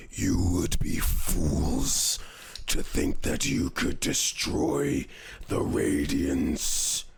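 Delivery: slow, deep, booming god voice; deep echoing underworld god. Acted. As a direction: boom it out like an ancient deity. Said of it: "you hear a booming voice echo".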